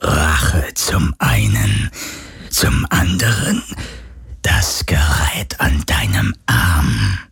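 a spirit savouring each word; low slow ghost voice. Acted. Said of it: "fragte die Geisterstimme".

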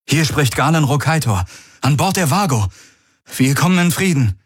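high, projected radio hail; hailing announcement. A narrator's reading. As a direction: call out to an unknown ship. Radio hail to alien object.

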